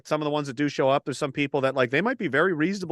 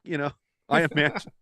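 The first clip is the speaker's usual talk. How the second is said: ordinary delivery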